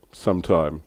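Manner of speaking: ordinary talk, nothing marked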